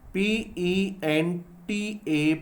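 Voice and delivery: letters spelled very slowly; slow letter-by-letter dictation; slow spelling out. Shown as a demonstration. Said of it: "pentameter क्या होता है?".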